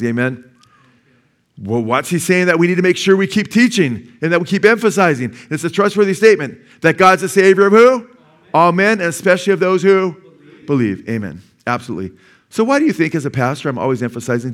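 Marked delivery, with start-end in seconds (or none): 1.6-11.1 loud, high, driving preacher's call; preaching at full force to the church. Acted. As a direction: build the sermon to a climax, pressing the congregation. Preaching climax, rising intensity.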